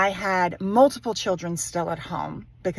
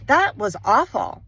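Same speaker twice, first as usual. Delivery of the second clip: usual speaking voice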